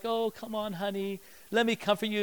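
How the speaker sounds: soft, coaxing mom voice; gentle mock-motherly soothing; comforting mother. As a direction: console quietly, as a mother would. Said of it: "And so mothers".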